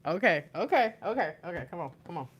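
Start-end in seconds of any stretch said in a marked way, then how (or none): none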